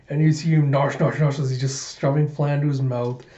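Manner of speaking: usual speaking voice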